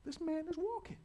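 high, amazed exclamations; amazed crowd. Retold or imitated. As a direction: gasp like a stunned crowd. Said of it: "They're just like".

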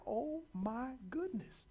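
high, amazed exclamations; amazed crowd. Retold or imitated. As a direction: gasp like a stunned crowd. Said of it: "They're just like".

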